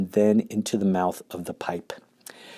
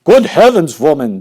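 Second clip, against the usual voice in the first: indignant father; 6 semitones higher, 10 dB louder than usual. Acted. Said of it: "said Mister Gloop".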